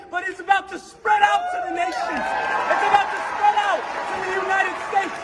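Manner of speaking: rally the room like a revival preacher; preaching at full force. Real, not acted. Young man preaching to the room.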